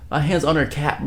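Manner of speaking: ordinary talk, nothing marked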